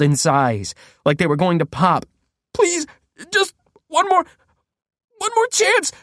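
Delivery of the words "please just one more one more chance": very high, frantic pleading; desperate babbling. Acted. Prisoner frantically babbling for another chance, very high. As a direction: babble for one more chance. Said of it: "I babbled".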